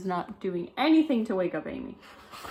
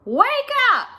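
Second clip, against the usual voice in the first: much higher, loud shout; yelling; 7 dB louder than usual. Acted. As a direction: bellow it to wake her. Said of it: "yelled at Amy as loud as she could".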